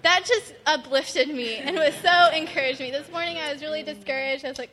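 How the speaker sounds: ordinary talk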